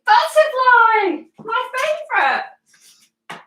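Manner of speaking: high, drawn-out delighted butterfly; excited. Real, not acted. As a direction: squeal it with delight. Excited at picking favourite pose.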